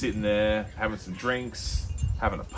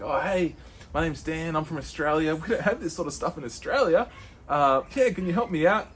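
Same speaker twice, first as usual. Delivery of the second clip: higher, bright-eyed newcomer voice; eager tourist; 8 semitones higher, 4 dB louder than usual. Retold or imitated. A tourist greeting the weed shop guy, higher and eager. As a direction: play yourself as a keen naive tourist.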